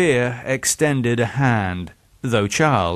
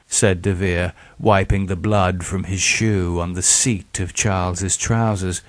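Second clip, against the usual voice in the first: ordinary delivery, nothing marked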